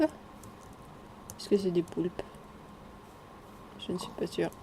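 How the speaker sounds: slow hushed whisper; secretive slow whisper. Real, not acted. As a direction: breathe it out slowly, barely voiced. Whispering to himself about octopi.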